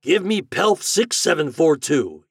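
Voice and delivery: high, loud, drawn-out call. A narrator's reading. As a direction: call the number out slowly into a telephone. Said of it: "he called".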